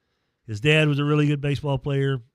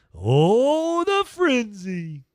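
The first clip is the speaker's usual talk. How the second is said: much higher, louder, slow announcer swoon; hammed-up hype; 9 semitones higher, 5 dB louder, much slower than usual. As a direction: savor the word frenzy like a promo. Hyping the show sponsor.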